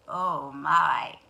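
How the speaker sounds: low, smooth fox voice; sly fox. Acted. Sly fox greets birds.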